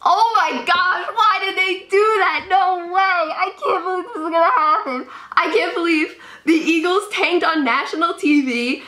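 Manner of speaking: very high, wailing protest; outraged disbelief. Real, not acted. Giants fan protesting the Eagles' trade, wailing. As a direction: wail it in real anguish at the top of your range.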